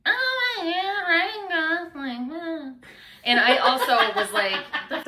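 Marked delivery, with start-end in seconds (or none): 0.1-2.7 high, breathless swooning gush; gushing fangirl. Pretend. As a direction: squeal his name like a smitten fan. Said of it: "Can you do that one more time?".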